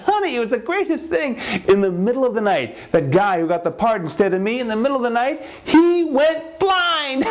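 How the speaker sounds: very high, gloating husband; hammed-up deranged delight; manic glee. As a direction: squeal it, stretch the final words, then burst out cackling. Husband gloats over rival's misfortune.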